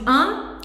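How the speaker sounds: un? pushed hard through the nose, rising like a question; overdone nasal vowel; exaggerated nasal un. Shown as a demonstration. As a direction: ham up the nasal un? as if asking someone. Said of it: "bem exagerado mesmo".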